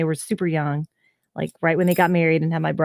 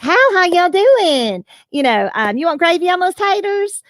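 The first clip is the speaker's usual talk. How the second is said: deep South Texan; 12 semitones higher, 5 dB louder than usual. Retold or imitated. Showing off old accent to host, hammed-up Texan accent. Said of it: "a deep South Texan".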